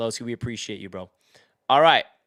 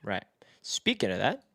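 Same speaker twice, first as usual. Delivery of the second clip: ordinary talk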